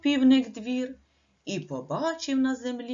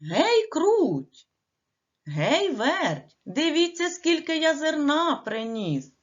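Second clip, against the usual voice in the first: higher, louder call across the yard; 6 semitones higher, 4 dB louder, slower than usual. Acted. Rooster calls the mice to see the grain, loud and high. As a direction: call the mice over proudly. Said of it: "і крикнув".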